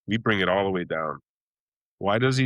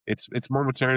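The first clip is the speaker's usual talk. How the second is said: normal talking voice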